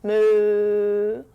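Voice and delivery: low, drawn-out cow moo. Shown as a demonstration. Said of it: "What sound does a cow make?".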